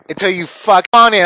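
ordinary talk, nothing marked

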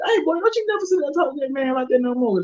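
high scolding grandmother voice; scolding grandma. Retold or imitated. Grandma scolds her grandson, high scolding old-lady voice. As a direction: play grandma telling the boy off. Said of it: "my grandma said".

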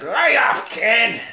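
mock film police chief; police chief order. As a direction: order the rookie to back down.

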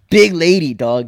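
usual speaking voice

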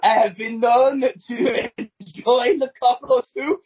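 slow, drawn-out French seductress; hammed-up flirting in a mock French accent; Melanie. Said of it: "Should I be Melanie?".

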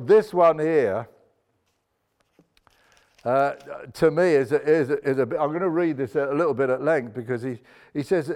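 ordinary talk, nothing marked

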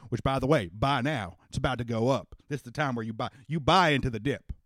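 a lightly voiced Texan father, rousing the crowd; folksy rally speaker. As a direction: speak up like a country politician winning over men. Daddy continues his speech.

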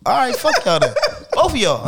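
laughing mock dismissal. Real, not acted. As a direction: brush them off while cracking up.

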